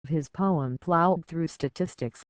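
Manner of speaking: ordinary talk, nothing marked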